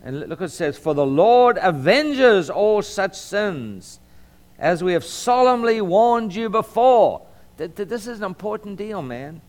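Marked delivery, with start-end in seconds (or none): 0.8-7.2 solemn warning, higher and louder; Southern Baptist preacher. Real, not acted. Pastor warning his congregation from scripture, forceful preaching. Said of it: "I sound like a Southern Baptist preacher".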